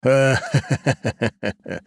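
short burst of laughter; can't hold back a laugh. Acted. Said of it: "Не смог сдержаться и рассмеялся".

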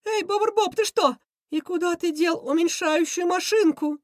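high-voiced villain demanding answers; high, bewildered schemer; puzzled Krysion. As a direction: quiz the henchman in a high cartoon voice. Baffled villain questions his henchman.

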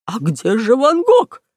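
slow, rising, alarmed crook; stretched-out baffled outcry; almost shouting. Acted. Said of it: "чуть не закричал".

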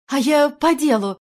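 loud, high, breathless protest; out of breath. A narrator's reading. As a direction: blurt it out while winded. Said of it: "запыхалась Надежда Николаевна". (запыхавшись, громко)

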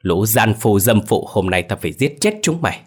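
plain reading voice

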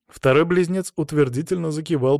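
ordinary delivery, nothing marked

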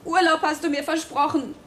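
usual speaking voice

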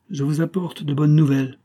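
forced smiling cheer over hidden rage; sinister smile. A narrator's reading. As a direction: announce good news through a cold grin. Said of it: "il se mit à sourire". (sourire forcé et sinistre)

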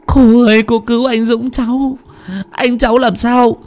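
high, breathless pleading; urgent young girl. A narrator's reading. Sister pleading with the aunt, urgent and high. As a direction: hurry the aunt along.

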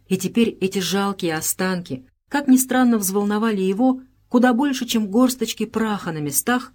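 usual speaking voice

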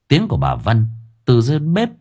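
ordinary delivery, nothing marked

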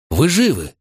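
high, startled question; surprised. Acted. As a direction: gasp it out in surprise. Fitz calls out in surprise.

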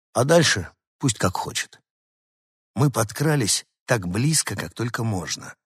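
ordinary delivery

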